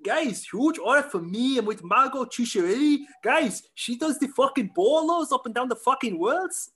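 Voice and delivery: a friend imitates the easygoing coach, slow and high; Bernardo Faria impression. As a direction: draw the words out like a chill Brazilian coach. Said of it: "a decent Bernardo".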